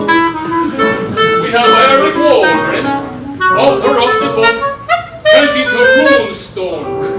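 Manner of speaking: loud, drawn-out introduction; big announcer delivery; announcing opponents. Acted. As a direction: present the opposing side to a crowd. Announces the opposing debate side.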